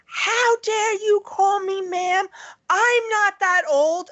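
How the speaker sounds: high, drawn-out outrage, imitating a little old lady; indignant old lady. Old woman scolds teenage worker for ma'am, mock indignant. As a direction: put on an offended old woman, high and slow. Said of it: "this little lady was like".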